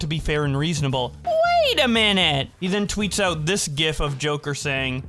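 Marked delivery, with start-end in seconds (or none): 1.3-2.5 mock surprise. Mock dramatic realisation.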